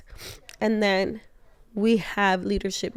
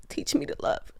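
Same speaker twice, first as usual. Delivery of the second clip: higher whispered plea; 6 semitones higher, 6 dB quieter than usual. Real, not acted. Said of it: "my one prayer always is".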